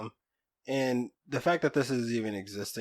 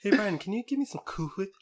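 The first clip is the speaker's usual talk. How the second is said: higher, with a strongly aspirated H in "Cool Whip"; Stewie's breathy H; 10 semitones higher, faster than usual. Retold or imitated. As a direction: puff hard through the H of "Whip" in "Cool Whip". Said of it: "why do you emphasize so much on the H".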